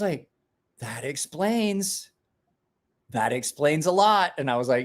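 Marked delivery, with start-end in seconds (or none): 0.8-4.3 slow realization. Retold or imitated. Wife's realization, drawn out.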